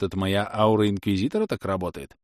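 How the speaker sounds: ordinary delivery, nothing marked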